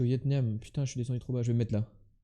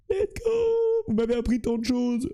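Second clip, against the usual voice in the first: excited cheer; 9 semitones higher, slower than usual. Pretend. Mock farewell cheer.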